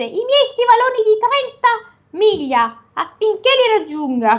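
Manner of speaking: high ogre command. Acted. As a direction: demand the boots as the ogre. Ogre orders wife.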